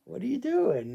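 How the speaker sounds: high teasing voice to a licking dog; playful pet voice. Real, not acted. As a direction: squeak it at a dog doing something odd. Dog acting odd again.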